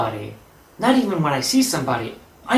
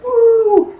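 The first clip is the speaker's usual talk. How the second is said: high, loud "woo"; happy whoop; 8 dB louder than usual. Retold or imitated. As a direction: let out a gleeful, drawn high whoop. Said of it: "I was kind of laughing".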